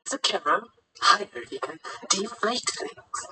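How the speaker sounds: high, hushed character voice; character's high voice. Acted. Teammate identifies the flattened enemy, high soft voice. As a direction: pitch it up and keep it soft.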